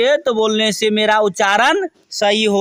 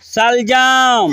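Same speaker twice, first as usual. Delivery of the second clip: slow, stretched final syllable; drawn-out modelling; much slower than usual. Shown as a demonstration. Teacher models a vegetable name for children to repeat, drawn out.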